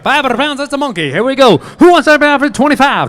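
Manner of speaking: loud, fast auctioneer chant; hammed-up auction call to the crowd; mock auctioneer patter. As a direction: rattle off bids like an auctioneer.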